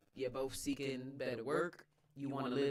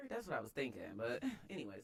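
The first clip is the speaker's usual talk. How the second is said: ordinary talk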